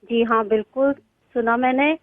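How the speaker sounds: normal talking voice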